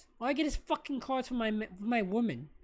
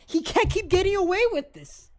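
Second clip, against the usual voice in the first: higher and louder, hammed-up indignation; mock outrage; 6 semitones higher, 7 dB louder than usual. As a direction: play it like a wronged man on a soapbox.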